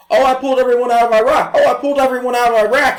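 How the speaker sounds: high, loud, boastful mock voice; mocking Obama. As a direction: brag it back in a hammed-up imitation. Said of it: "running around going".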